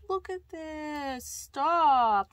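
gush, then burst out in happy disbelief; gushing delight. Real, not acted. Gushing over stickers.

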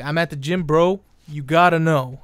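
loud hammed-up gym bro brag; mock gym bro. Mocking gym posters, a loud bragging bro voice. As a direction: bellow it like a show-off bro at the gym. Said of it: "they're like".

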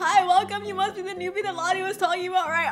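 loud, high-pitched fangirl welcome; excited gushing. Real, not acted. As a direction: gush in a squeal of delight. Gushing as favourite character appears.

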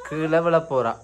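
ordinary talk

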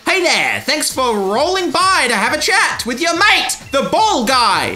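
bubbly mascot handing out a ball; Ball Guy voice. Acted. Ball Guy greets the player, high and cheery.

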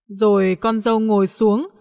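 ordinary delivery, nothing marked

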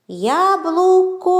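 Яблуко slowly, with the final О held high and long; виділений звук О. Shown as a demonstration. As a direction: stretch the last О of Яблуко. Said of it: "Визначимо місце звука О у цих словах". («Яблуко» повільно, з протяжним високим «о» в кінці)

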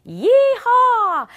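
high, loud yeehaw; gleeful holler; whooping. Retold or imitated. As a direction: whoop it like a rodeo rider. Others cheering on bumpy plane.

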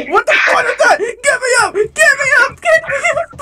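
high, tearful shriek; panicked screaming. Real, not acted. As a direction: beg to be pulled up, voice rising into panic. Panicking, trapped in game.

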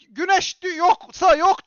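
a loud, exasperated cry at the viewers; retold yell. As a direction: shout it the way you yelled at home. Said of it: "Bağırdım".

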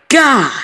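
sharp high yelp of pain "Gah"; a sudden stabbed cry, high and loud; scream. Acted. Narrator screams as the knife goes in, high loud yelp. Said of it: "I grabbed her hand and screamed".

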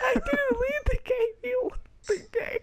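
high squealing laugh-shout. Real, not acted. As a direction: yell through the laughter. Laughing argument about leaving.

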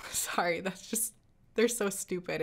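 breaking into a laugh mid-sentence; can't hold back the giggle. Real, not acted. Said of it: "hard to keep a straight face".

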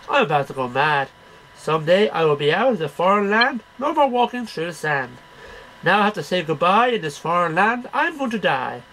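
usual speaking voice

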